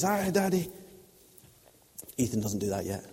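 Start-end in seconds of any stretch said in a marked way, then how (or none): 0.0-0.7 put-on high, sweet toddler voice; little child's greeting. Toddler running to greet his dad, in a high little voice. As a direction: say it as a small boy running to dad. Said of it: "and he says".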